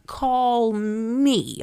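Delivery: hammed-up flirting at a celebrity crush; playful, insistent invitation; playfully flirty. Playful flirting with Jonathan Majors.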